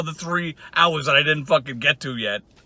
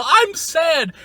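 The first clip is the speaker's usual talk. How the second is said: mock sad; 10 semitones higher than usual. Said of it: "when you go to the hospital".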